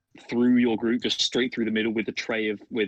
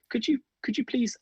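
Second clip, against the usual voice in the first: much higher, pleading and disgusted; squeamish barmaid; 13 semitones higher than usual. Acted. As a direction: beg them to take it away. Barmaid pushes head away.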